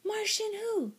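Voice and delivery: very high kitten question. A narrator's reading. Kitten questions unseen voice, high-pitched. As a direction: ask it high, puzzled at the answer.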